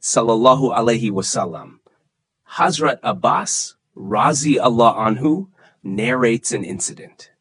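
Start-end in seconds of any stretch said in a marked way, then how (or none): none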